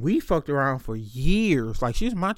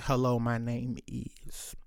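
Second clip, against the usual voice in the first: smooth intro; 5 semitones lower, 10 dB quieter, slower than usual. Retold or imitated. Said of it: "I was like".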